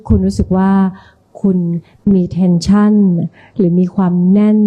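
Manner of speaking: slow, soft guided relaxation voice; soft spa whisper. Shown as a demonstration. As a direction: lead the group calmly through breathing. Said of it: "ดิฉันเข้าโหมดผู้นำกระบวนการได้อย่างรวดเร็ว".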